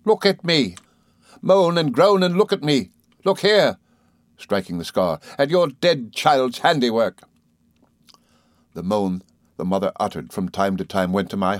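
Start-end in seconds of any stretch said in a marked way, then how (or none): 0.1-3.8 high, loud, scornful attack; furious accusation. Acted. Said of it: "cried Rosa". 5.4-7.2 furious accusation. Acted. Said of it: "cried Rosa".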